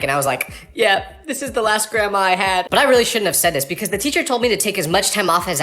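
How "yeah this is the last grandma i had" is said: hammed-up grieving excuse; overdone mournful act; super dramatic. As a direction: lay on fake grief to win sympathy. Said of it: "so I made it super dramatic".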